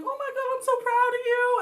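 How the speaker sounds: high, bright praise; proud gushing. Retold or imitated. Classmates telling the new lead they're proud, high and gushing. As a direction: gush over her like a proud friend.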